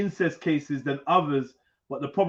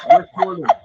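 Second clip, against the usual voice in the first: Westmoreland hammed up in a squeaky high mock accent; a silly put-on accent, mocking the correction; 8 dB louder than usual. Mocks friends' pronunciation demand with silly accent. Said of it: "you want me to say it in an accent".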